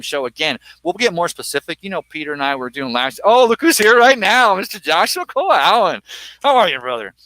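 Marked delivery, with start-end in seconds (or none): 3.2-7.1 surprised welcome. Real, not acted. Host spots co-host arriving at the bar, surprised welcome. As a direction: break off into a big delighted welcome.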